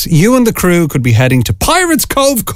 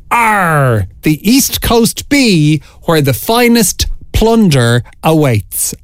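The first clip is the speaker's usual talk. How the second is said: slow, higher-pitched pirate voice; 3 semitones higher, slower than usual. Shown as a demonstration. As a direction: play the pirate, stretching every word. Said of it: "in your best pirate voice".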